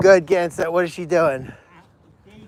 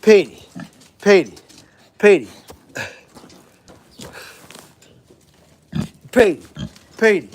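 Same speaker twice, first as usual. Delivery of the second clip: short, loud calls of the name; calling an animal; 3 dB louder than usual. Real, not acted. Keeper calls Petey over for a tomato, calling out. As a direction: call the animal over, one sharp word at a time.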